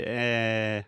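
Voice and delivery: a short "Eh." as a hesitation sound; Brazilian thinking sound eh. Shown as a demonstration. Teacher showing a student her filler "Eh.". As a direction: voice the Portuguese filler "Eh." with an open e. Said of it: "I also hear you say".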